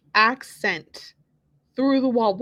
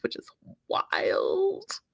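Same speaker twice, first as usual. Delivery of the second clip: whispered, slow aside; 7 semitones higher, 6 dB quieter, much slower than usual. Real, not acted. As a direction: breathe it out in disbelief. Reacting to Cal's remark.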